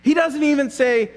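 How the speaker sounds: ordinary talk, nothing marked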